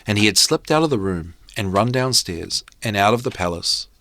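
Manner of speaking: ordinary delivery, nothing marked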